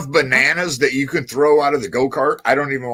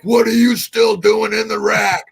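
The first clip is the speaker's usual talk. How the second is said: high, raised imitated sergeant bark; drill sergeant voice; 7 semitones higher, 4 dB louder than usual. Dad rousting trainee out of bed, imitated sergeant bark. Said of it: "barking with that drill sergeant voice".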